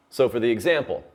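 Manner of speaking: usual speaking voice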